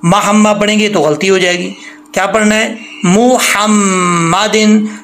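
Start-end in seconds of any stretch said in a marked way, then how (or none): 3.0-5.0 slow मुहम्मदिन with a clear मु; careful, drawn-out मुहम्मदिन; slow recitation. Shown as a demonstration. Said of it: "इस पे आपने ख़ास तौर पे प्रैक्टिस करनी है".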